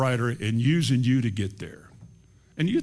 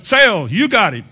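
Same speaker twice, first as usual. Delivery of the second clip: high, loud auctioneer's cry; mock auctioneer; 8 semitones higher, 11 dB louder than usual. Preacher mocking a man for sale, auctioneer's shout.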